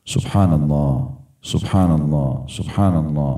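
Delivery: low, unhurried recitation, each phrase separate; slow, reflective dhikr. Shown as a demonstration. Preacher models dhikr to be absorbed, slow and low. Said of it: "pelan-pelan".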